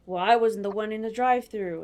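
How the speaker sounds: loud, snippy retort from the cashier; imitating a rude cashier's loud comeback; snippy attitude. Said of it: "the lady gave me a whole attitude".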